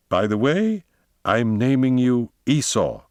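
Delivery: high-pitched, deliberate naming of a pet; self-important, drawn-out pronouncement; lordly announcement. A narrator's reading. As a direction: announce it slowly, like a master. Master grandly names his new dog.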